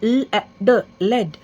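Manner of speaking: slow letter-by-letter blend ल, ए, ड into लेड; phonics sounding out. Shown as a demonstration. Teacher sounding out a word for young learners, slow and clear. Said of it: "जैसे यहाँ पर three letter words हैं हमारे पास".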